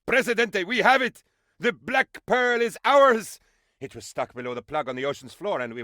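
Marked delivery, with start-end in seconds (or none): 0.1-3.4 high, loud, triumphant cheer. Acted. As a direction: announce the victory with glee. Triumphant news of the pearl.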